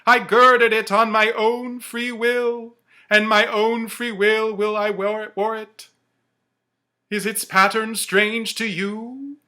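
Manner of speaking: high, loud, slow ghostly wail; Marley's ghost. Acted. The ghost tells Scrooge of his chain, wailing high and loud. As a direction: moan each word like a chained spirit.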